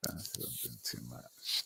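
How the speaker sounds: whispered echo of the chat comment. Real, not acted. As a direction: breathe it out with no voice. Whispered repeat.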